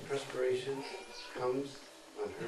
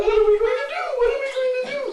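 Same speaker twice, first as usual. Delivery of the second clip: desperate cry; 9 dB louder, much faster than usual. Acted. Jatila despairs over Radharani.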